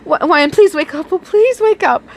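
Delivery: high, urgent begging; panicked pleading. Acted. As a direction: beg him to wake up.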